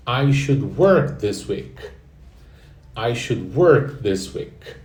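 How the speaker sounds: slow, careful teacher model of "I should work this week"; slow model sentence. Shown as a demonstration. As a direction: say "I should work this week" slowly for them to echo. (frase modelo lenta para repetir)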